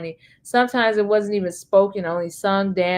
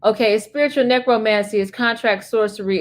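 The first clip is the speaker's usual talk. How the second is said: normal talking voice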